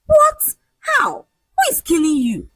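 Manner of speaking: very high, incredulous questions; high-pitched shocked outburst; stunned disbelief. A narrator's reading. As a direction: squeal it in disbelief. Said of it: "Ola's mouth hung open".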